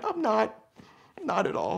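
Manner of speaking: small, high-pitched confession; pained admission. Retold or imitated. Stung man admitting pain to his friend, high small voice. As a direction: give in with a high little whimper-like voice.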